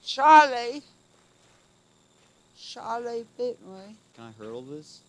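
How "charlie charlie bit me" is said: put-on high, slow little-kid whine; a toddler's pained, drawn-out wail; Charlie bit me kid. Quoting the viral Charlie video.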